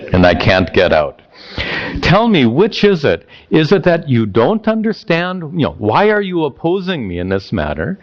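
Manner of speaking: hammed-up sarcasm with swooping questions; sarcastic, frustrated teenager. Prince paraphrased as teenager to his dad, sarcastic frustration. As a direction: play a fed-up son, drawing out the jabs. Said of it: "It is dripping with sarcasm and, and anger and frustration".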